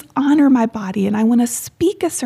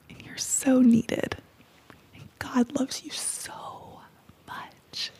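whispered affirmations; 5 dB quieter than usual. Real, not acted. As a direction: whisper each blessing gently to her. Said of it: "I just wanted to say a few things".

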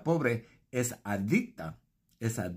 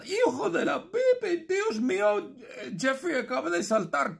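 very high laughing shriek; screaming in shock. Retold or imitated. Supermono and Norma watching Jeffrey jump, very high shriek. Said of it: "Y Supermono y Norma gritan".

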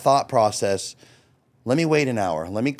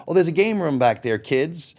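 put-on cheery call to the kids; 3 semitones higher than usual. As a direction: sell the kids on the game room with big enthusiasm. Sending kids off to play.

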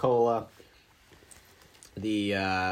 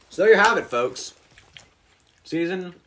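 host sign-off; 6 semitones higher, 6 dB louder than usual. Real, not acted. Host wraps up the show.

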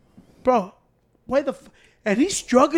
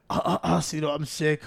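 fake sick; 6 semitones lower, 7 dB quieter than usual. Fake coughing sick act.

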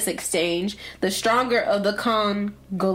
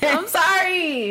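nervous laugh; 8 semitones higher, slower than usual. Real, not acted. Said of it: "I literally can't talk".

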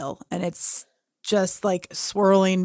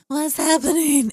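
mock alarm; 8 semitones higher, 5 dB louder than usual. Retold alarm at sudden chill.

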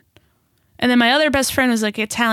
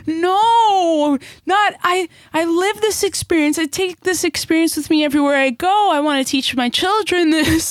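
higher-pitched, mock-indignant insistence; retold defensive protest; 7 semitones higher than usual. Speaker retelling protest to best friend, higher defensive voice. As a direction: protest as if roots were questioned.

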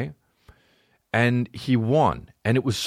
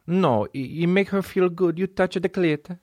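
put-on Italian accent; 10 semitones higher than usual. Italian voice in quoted dialogue.

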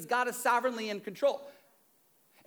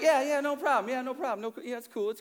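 a higher, eager rush of "no problem", settling down; playing it cool; 6 semitones higher than usual. Pastor re-enacting his cool reply to the teen, mock casual. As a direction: fake nonchalance over real excitement. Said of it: "I was like".

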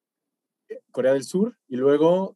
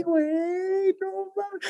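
1 thrilled high squeal; much slower than usual. Retold or imitated. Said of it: "Me decía".

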